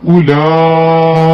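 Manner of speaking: उलाइका very slow, लाम drawn long; slow stretched recitation. Shown as a demonstration. Teacher showing how उलाइका is recited, ला drawn out. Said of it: "लाम को खींचना है".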